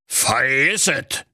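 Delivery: put-on slow, disdainful old miser; old miser refusing coldly; curt refusal. A narrator's reading. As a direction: shut him down. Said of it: "zischte da der knallhart knauserige".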